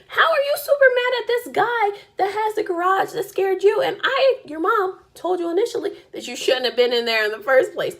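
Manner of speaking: high, loud incredulous telling-off; exasperated scolding. Retold or imitated. Mom scolds scared daughter over haunted garage, exasperated and loud. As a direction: start high and loud, easing down as you scold.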